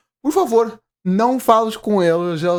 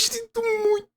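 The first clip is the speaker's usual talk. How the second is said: high, soft imitation of the mother sobbing; mock crying; 13 semitones higher, 7 dB quieter, slower than usual. Viewer imitating the apologizing mother, mock sobbing. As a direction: whimper it like the sad mother. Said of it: "Ela ainda diz".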